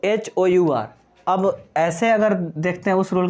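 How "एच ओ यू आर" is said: H O यू आर spelled slowly, letter by letter; spelling slowly. Shown as a demonstration. Teacher to students, slow letter-by-letter spelling. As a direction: read each letter out slowly. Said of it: "तो".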